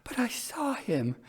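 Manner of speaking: high, trembling, haunted telling; very wildly. A narrator's reading. Elder sister wildly tells her sister of the pale wet visitor. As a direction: describe the vision as if still seeing it. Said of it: "still very wildly".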